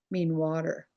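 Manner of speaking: ordinary delivery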